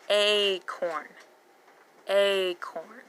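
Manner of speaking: "Acorn" slowly, with a long stretched AY up front; long A sound. Shown as a demonstration. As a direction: draw out the first vowel of "Acorn". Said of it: "And our long A".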